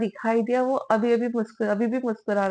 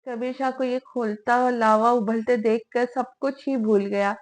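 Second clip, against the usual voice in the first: usual speaking voice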